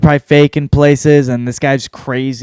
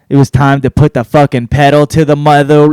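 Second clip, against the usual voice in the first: normal talking voice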